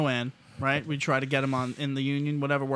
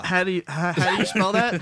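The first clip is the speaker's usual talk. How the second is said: mock puzzled lady; 5 semitones higher, 6 dB louder than usual. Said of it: "He's like".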